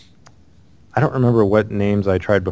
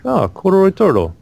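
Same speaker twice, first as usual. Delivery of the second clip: high-pitched, badly faked British accent; horrible British accent; 12 semitones higher, 4 dB louder than usual. Gamer quoting a British stranger reading his name, horrible British accent. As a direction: put on a terrible British accent that slides toward Russian. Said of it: "I'm doing a horrible accent".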